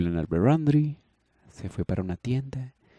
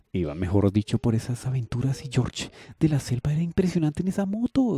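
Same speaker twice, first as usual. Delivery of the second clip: usual speaking voice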